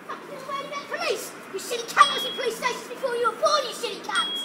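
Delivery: normal talking voice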